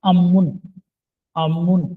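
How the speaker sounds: अमुन with long m, अ-मुन; correct reading. Shown as a demonstration. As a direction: say अमुन holding the m. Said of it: "क्या पढ़ा जाएगा".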